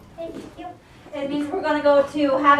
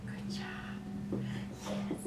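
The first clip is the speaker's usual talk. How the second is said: whisper; 17 dB quieter, much slower than usual. Real, not acted. Soft praise while training.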